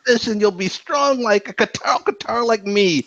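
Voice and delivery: high, boisterous laughing brag; big hearty ha-ha into a high-pitched boast; hammed-up boisterous cartoon character; boisterous Aisha. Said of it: "like, boisterous".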